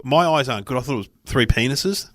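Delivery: ordinary delivery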